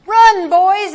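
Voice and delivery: loud high scream. Acted. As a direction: scream the warning at the boys. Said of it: "as she screamed".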